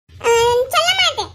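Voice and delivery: put-on high-pitched childish refusal; defiant child. Boy refusing to tell teacher, defiant child voice. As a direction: refuse like a stubborn little kid.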